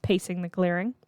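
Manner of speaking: plain reading voice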